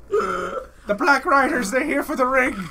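loud, high, drawn-out dramatic warning; mock alarm. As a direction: cry out a hammed-up warning. Fantasy film alarm, acted.

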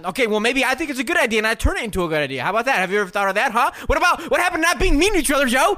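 shouting. Acted. Heated mock argument.

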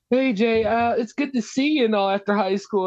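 high-pitched mock friendly small talk; imitated chummy classmate. Former classmate approaching her after high school, imitated chummy greeting. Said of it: "trying to talk to me like this".

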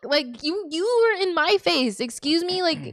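high-pitched, offended disbelief; retold shocked protest; taken-aback indignation. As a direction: react baffled, pitch jumping up. Retelling shocked reply to the insult.

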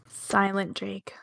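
softly and slowly drawn out; hushed and slow. Pretend. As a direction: say it hushed, like Jake must. Joke about Jake staying quiet.

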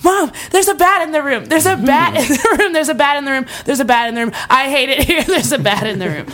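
panicked repeated cry, breaking into laughs; retold freak-out. Reenacting flipping out to mom over a bat, mock panic. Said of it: "and I flip out".

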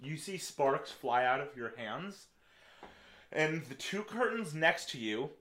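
slow dramatic scene setting; dramatic narration. Acted. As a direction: build the blaze slowly. DM narrates the fire.

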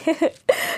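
high breathy giggle. Real, not acted. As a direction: giggle at your own joke. Laugh after punchline.

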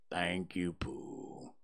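low, slow, whispered and mournful; gloomy donkey Eeyore. Acted. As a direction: sigh it out like a sad old donkey.

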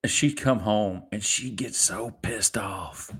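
slow hushed whisper. Real, not acted. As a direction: lean in and whisper it slowly. Whispered retelling of wife's anger.

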